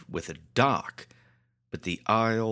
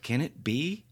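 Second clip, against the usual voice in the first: ordinary delivery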